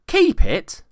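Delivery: very high, loud disbelief; incredulous. A narrator's reading. Nephew astonished at his uncle's words, high disbelief. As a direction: echo it in amazement.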